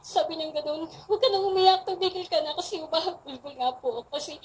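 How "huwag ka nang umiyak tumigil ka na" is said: higher and louder, pressing her to stop crying; insistent urging. Retold or imitated. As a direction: coax firmly, voice raised high.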